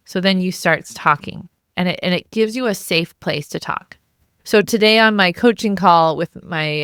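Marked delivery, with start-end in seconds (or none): none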